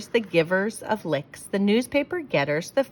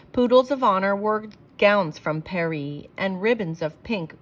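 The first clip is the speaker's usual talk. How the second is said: plain reading voice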